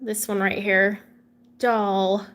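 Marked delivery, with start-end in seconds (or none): 1.6-2.3 "Doll" alone with an open ah vowel; "Doll" like the toy; corrected pronunciation. Shown as a demonstration. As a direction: repeat "Doll" low and drawn out as the right way. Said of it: "it's not dull, it's doll".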